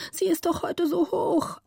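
put-on high girl's voice, worried pleading; a little girl's high pleading voice; anxious little girl. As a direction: plead like a small scared daughter. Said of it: "rief Gritta".

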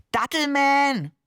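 drawn-out, high, loud call; excited call. A narrator's reading. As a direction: call out the name slowly and proudly. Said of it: "rief der Seestern". (begeistert gedehnter, lauter Ausruf)

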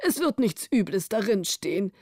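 a king giving an easy order; the king speaking. A narrator's reading. King to Prince Bonus, calmly regal. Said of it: "sagte der König".

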